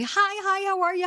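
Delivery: high, bright happy hellos; excited greeting. Retold or imitated. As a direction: squeal your hellos. Greeting friends at market.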